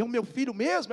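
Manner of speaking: high-pitched questioning, voice not yet at full volume; imitated tearful disbelief. Mother on phone to lost son, emotional disbelief. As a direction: act a mother's first incredulous question. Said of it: "Ela em prantos começou a falar".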